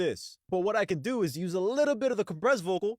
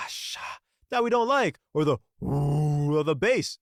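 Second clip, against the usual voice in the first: imitated frequencies; slower than usual. Showing viewers the highs and lows, imitating frequencies. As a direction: voice the treble and the bass sounds. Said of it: "I sound ridiculous".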